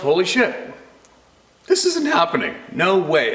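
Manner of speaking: mock stunned boater; alarmed disbelief. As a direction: think it in shock. Inner thought, alarmed.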